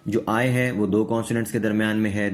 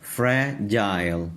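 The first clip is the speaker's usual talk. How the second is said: Fragile drawn out slowly, each part separate; teacher's slow model of fragile; slow syllable demo; much slower than usual. Shown as a demonstration. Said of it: "تو اب ہم اس کو پڑھیں گے جائل".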